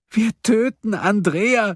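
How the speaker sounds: high, slow anguished wail; sobbing. A narrator's reading. Woman horrified at killing a girl, sobbing. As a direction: wail it slowly through tears. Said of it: "schluchzte Silje".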